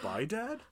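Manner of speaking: comic farewell with a questioning lift; mock uptalk. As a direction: swing "Dad" up into a question. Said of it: "just like my sentence".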